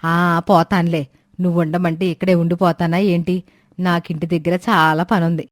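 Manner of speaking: put-on old woman Suramma. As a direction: grumble like an impatient old granny.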